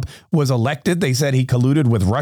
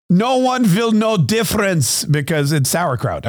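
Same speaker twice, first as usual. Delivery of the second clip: slow, higher-pitched German kraut lady; mock German accent; 4 semitones higher, slower than usual. German kraut lady voiced in story.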